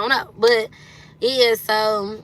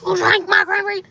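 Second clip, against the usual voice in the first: outraged, much higher and louder accusation; 10 semitones higher, 4 dB louder than usual. Retold or imitated. Confronting her nephew about the juice, outraged sass. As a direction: channel a sassy, furious diva. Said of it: "I'm giving [name] off Barbershop 'cause I'm pissed".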